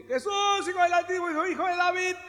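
high, far-reaching plea to Jesus; pleading cry. Acted. Mother calls to Jesus on the road, high pleading cry. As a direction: call out after him, begging.

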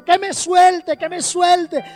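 berrinche de niña. Retold or imitated. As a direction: mimic a little girl insisting to be let go. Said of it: "empezó a hacer un berrinche". (berrinche infantil imitado, voz aguda)

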